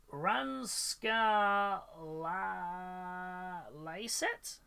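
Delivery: "Ranskalalaiset" sounded out very slowly, syllable by syllable; careful Finnish attempt. Shown as a demonstration. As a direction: drag out each syllable of "Ranskalalaiset" unsure. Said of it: "I'm gonna butcher saying this".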